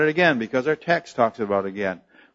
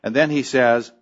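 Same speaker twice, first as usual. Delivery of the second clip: ordinary delivery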